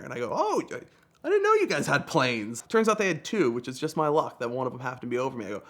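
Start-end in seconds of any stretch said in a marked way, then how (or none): 0.3-2.6 high-pitched innocent "oh"; mock surprise. Driver learning of police planes, acting surprised. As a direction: play mildly astonished, pitched up at first.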